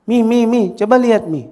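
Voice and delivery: put-on high, loud child voice; loud child calling. Child calling mother to look, loud high pestering. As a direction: call Mum urgently, high and loud. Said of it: "suaranya boleh pelan-pelan".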